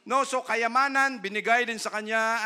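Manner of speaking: preacher at full force to congregation; full-force preaching. Acted. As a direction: preach it with fire.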